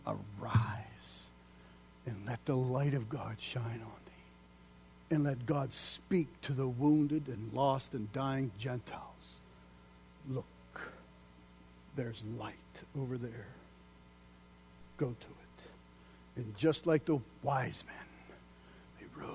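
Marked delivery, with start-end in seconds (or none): 0.1-5.1 hushed, slow, breathless whisper; fervent whisper. Real, not acted. Preacher whispering a blessing over the church. 10.3-15.4 hushed guiding voice. Acted. God's voice softly pointing.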